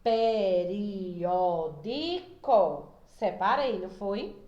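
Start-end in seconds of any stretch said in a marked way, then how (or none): 0.0-2.9 very slow PE-RI-Ó-DI-CO, each syllable held; split into syllables. Shown as a demonstration. Teacher separating the example word for pupils, syllable by syllable. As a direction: stretch "Periódico" out syllable by syllable. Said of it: "Vamos separar".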